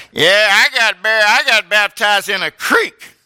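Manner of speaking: loud, slow, high mocking brag. Preacher mocking boastful churchgoers, loud slow brag. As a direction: lampoon the show-off who boasts about a creek. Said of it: "you people that brag".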